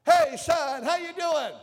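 put-on bright upbeat greeting from an old father; retold happy dad on the phone; excited dad. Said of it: "he was always so excited".

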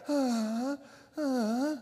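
very soft, weak whimpering grunts; feeble whimper. Retold or imitated. Mimicking someone worn out, soft whimper. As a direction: sound like you're on your last leg. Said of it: "you're on the last leg".

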